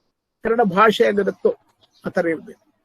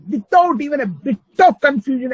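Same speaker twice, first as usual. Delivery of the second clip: normal talking voice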